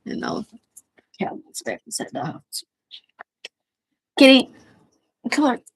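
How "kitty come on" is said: loud high call out the door; calling the cat. Real, not acted. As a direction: call the kitty home.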